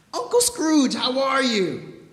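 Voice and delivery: loud, high cheery hail to his uncle; mock hearty greeting. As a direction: call it out brightly to Uncle Scrooge. Fred acts out greeting his uncle.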